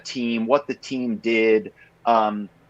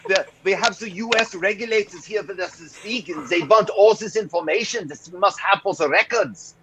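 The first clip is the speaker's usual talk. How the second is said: higher, hammed-up German accent, put-on banker; 7 semitones higher than usual. Caller posing as Frankfurt banker asking for records, hammed-up German accent. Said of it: "I had this whole thing I would do".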